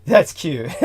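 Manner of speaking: ordinary talk, nothing marked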